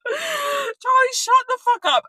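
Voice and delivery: very high, loud yell at oneself; self-scolding shout. Real, not acted. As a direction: yell at yourself to stop rambling. Tells herself to stop rambling.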